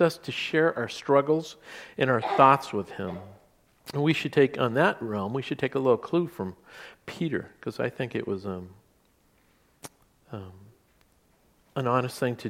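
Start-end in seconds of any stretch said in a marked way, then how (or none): none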